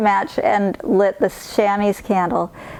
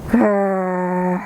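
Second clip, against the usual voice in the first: bear growl. Acted.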